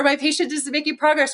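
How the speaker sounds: a touch of a high whine; mock complaint. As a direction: gripe like a frustrated therapist. Mocking therapist complaint.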